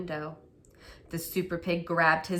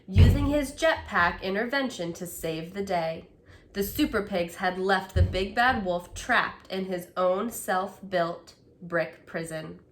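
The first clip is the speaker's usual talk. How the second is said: ordinary delivery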